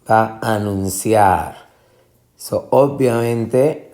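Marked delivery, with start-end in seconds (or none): 0.5-1.7 anunciar landing on a long CIAR; final stress. Shown as a demonstration. Teacher confirming the rhythm, anun-CIAR stressed last. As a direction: stretch CIAR at the end of anunciar. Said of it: "ciar es la sílaba".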